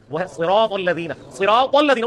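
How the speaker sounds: सिरातल with a heavy ṣ and ṭ, said slowly; correct Arabic reading. Shown as a demonstration. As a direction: recite सिरातल लज़ीना with thick Arabic letters. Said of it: "तो सिरातल लज़ीना तो नहीं है वो".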